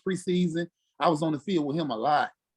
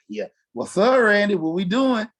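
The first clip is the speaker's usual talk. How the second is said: louder, higher eager hello; excited greeting; 6 semitones higher, 4 dB louder than usual. Retold or imitated. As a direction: greet him like a hyped kid. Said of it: "I'm like".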